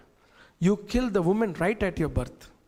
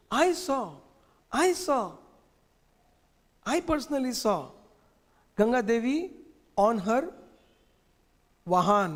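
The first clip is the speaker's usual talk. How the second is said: put-on higher, eager boy's voice; boy convincing his doubtful father; child Krishna insisting; 4 semitones higher than usual. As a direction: insist like a child who truly saw it.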